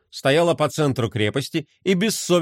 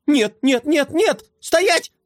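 high panicked shout, rising higher; panicked yelling; 10 semitones higher, slower than usual. A narrator's reading. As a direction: yell in a tiny panic. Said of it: "вопил Мелкотрон".

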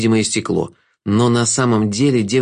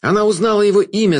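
high, excited exclamation, not truly shouted; astonished high-pitched outcry; crowd's cry; 9 semitones higher, faster than usual. A narrator's reading. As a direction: call out the news from the crowd. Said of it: "закричали в толпе".

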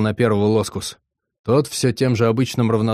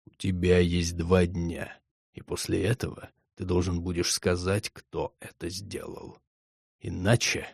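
cold hissing layered whisper; 3 semitones lower, 8 dB quieter than usual. A narrator's reading. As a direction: threaten from beyond the grave. Overlord threatens the messenger.

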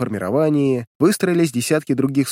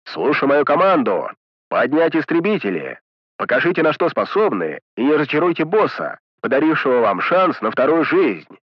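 drill commander; 2 semitones higher than usual. Acted. Джолтак commands pilots over radio.

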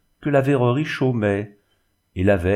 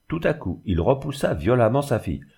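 ordinary delivery, nothing marked

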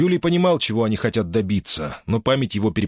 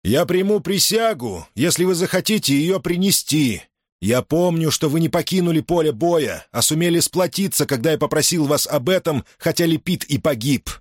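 addressing the troops; 10 semitones higher than usual. A narrator's reading. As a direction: make every soldier hear you. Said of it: "набрал в грудь побольше воздуха, чтобы каждый солдат ... услышал его".

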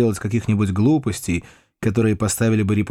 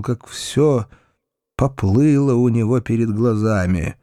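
plain reading voice

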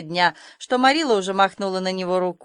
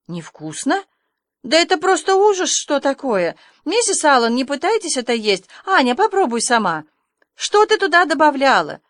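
shocked scolding; 6 semitones higher, 4 dB louder than usual. A narrator's reading. Marilla scolds over ruined pie.

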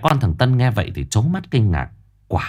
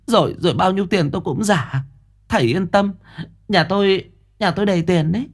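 higher, eager pleading mother; 7 semitones higher than usual. Acted. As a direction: gush at the master, offering money.

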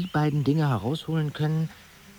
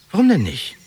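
much higher, louder demand; insistent; 7 semitones higher, 7 dB louder than usual. Acted. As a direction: push hard against the no. Pushing back on refusal.

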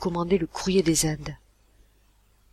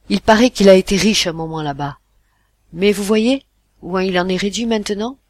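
louder, dry, brusque captain; curt reply; 9 dB louder than usual. Acted. Captain answering the father's questions, irritated and curt. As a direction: brush off the nosy passenger curtly. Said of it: "répondit sèchement".

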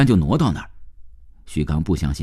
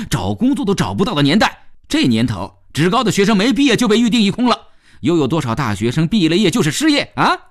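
sarcastic man in a heated rebuttal, pitch leaping on jibes; indignant young worker; 8 semitones higher than usual. Acted. Worker rebutting charge of envy, scornfully indignant. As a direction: throw the accusation back with scorn.

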